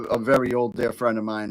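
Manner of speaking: normal talking voice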